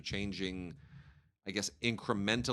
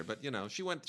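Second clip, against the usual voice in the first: usual speaking voice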